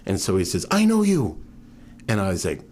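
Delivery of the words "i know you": much higher and louder, eager; excited recognition. Retold or imitated. Young man in the pub recognizing him, eager and loud. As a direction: call it out as if spotting an old friend.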